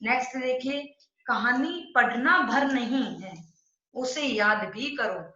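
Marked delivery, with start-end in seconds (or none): 1.3-5.2 slow, with stress on भर and भी; slow emphatic reading. Shown as a demonstration. Teacher reading the example slowly to her students. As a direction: weigh each particle as you read. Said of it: "दो निपात शब्द".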